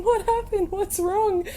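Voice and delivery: high, fake-worried voice; mock concerned tour leader. Tour leader running up pretending concern, high mock worry. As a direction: play the innocent prankster asking.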